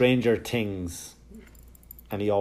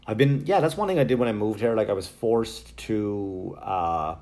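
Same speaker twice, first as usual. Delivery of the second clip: ordinary talk, nothing marked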